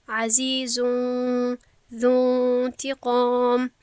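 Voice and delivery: عزیزون ذو انتقام slow, nun hidden in a nasal hum; slow Quranic chant of عزیزون ذو انتقام; ikhfa recitation. Shown as a demonstration. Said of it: "باید بگویم".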